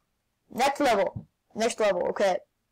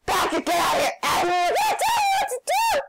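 high yell rising into a squeal; frantic yelling; 13 semitones higher, faster than usual. Real, not acted. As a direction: shoo him away, voice shooting up high. Yelling at friend mid-game.

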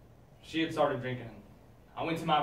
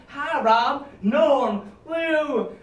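sitcom greetings; 9 semitones higher, 7 dB louder, much slower than usual. Acted.

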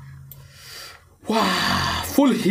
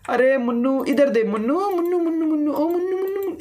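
calling a pet; 5 semitones higher than usual. Pretend. Gamer coaxing a baby sheep, cooing call. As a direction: coax the little lamb over, sing-song.

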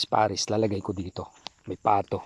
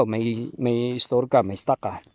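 ordinary talk, nothing marked

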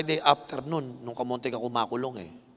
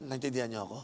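normal talking voice

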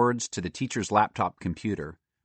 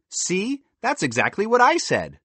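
put-on high, pointed girl's agreement; triumphant I-told-you-so; vindicated; 11 semitones higher, 3 dB louder than usual. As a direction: crow that you were right, pitched high. Girl feels vindicated by squirrel.

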